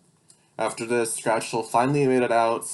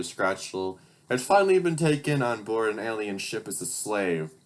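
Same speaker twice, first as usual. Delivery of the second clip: usual speaking voice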